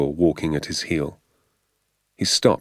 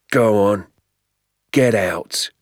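gruff order; 3 semitones higher, 4 dB louder, slower than usual. Acted. Keeper orders them away.

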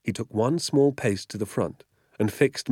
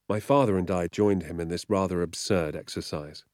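usual speaking voice

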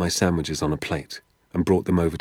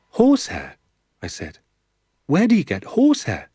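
put-on puzzled boy; 8 semitones higher, 4 dB louder than usual. Danny's puzzled questions.

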